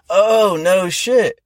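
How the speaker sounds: high, slow, drawn-out mock "no shit"; imitating the park owner's amazement, stretched way out; impressed park owner. Retelling the park owner's reaction in a high, stretched-out voice.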